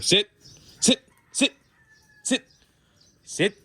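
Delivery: stern repeated sit commands; firm dog command. Real, not acted. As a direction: make the dog obey.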